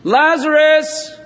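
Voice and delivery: loud, high, slow call; shout. Acted. Jesus calling into the tomb, a loud slow shout. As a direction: call Lazarus out of the tomb at full voice. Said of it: "Jesus shouted".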